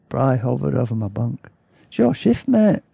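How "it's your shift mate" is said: high-pitched attempted Yorkshire accent; Bry. A narrator's reading. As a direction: rouse him in a shaky attempt at Yorkshire. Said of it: "Can't even do a Yorkshire accent".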